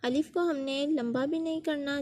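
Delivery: ordinary delivery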